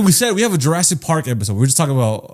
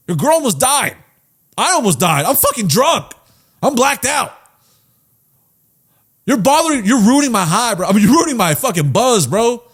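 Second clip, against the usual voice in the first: high-pitched, worked-up mock rant; angry telling-off; 8 semitones higher, 3 dB louder than usual. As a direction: berate him like a drunk, fed-up moviegoer. Acted telling-off of the character.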